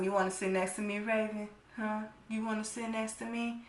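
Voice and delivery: mock a creep luring her closer; a creepy guy whispering an invite; creepy coaxing.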